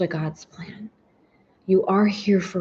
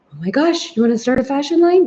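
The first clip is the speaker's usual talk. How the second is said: excited inner voice; 6 semitones higher, 5 dB louder than usual. Retold or imitated.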